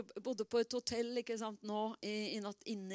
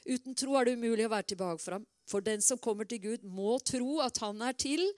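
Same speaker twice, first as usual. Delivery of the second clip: ordinary talk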